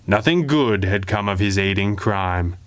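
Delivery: ordinary delivery, nothing marked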